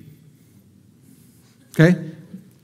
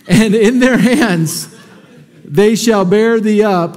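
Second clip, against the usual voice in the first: slow reading through laughter; laughing while reading; slower than usual. Real, not acted. Preacher amused by King James wording, laughing through the verse. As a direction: read the verse but can't stop laughing.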